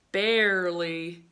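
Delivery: slow, loud, put-on bear voice. Teacher redoing the bear's line for the class in a slow bear voice. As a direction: give the bear a lumbering slow delivery.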